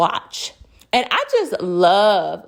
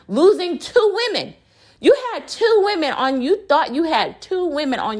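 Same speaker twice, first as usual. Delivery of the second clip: heated rant; 7 semitones higher than usual. Real, not acted. Ranting, pitch jumps up.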